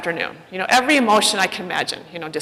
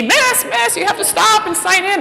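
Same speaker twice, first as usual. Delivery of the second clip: much higher, calling out Miss, miss; calling after her; 11 semitones higher, 3 dB louder than usual. Retold or imitated. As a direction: chase her down with a high call of Miss, miss. Staffer chasing her, calling out.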